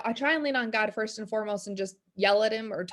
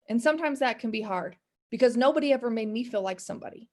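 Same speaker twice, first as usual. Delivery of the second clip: usual speaking voice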